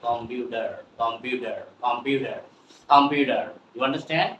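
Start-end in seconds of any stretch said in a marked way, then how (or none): 0.1-0.7 "কম্পিউটার" with D in place of T. Shown as a demonstration. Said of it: "এই জন্য হয়ে যাবে". 1.0-3.4 "কম্পিউটার" with D in place of T. Shown as a demonstration. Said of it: "এই জন্য হয়ে যাবে".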